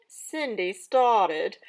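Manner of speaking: loud, lively storybook narration; dramatic story reading. Acted. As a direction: read it aloud like a theatrical stepmother. Teacher reads stepmother's story aloud.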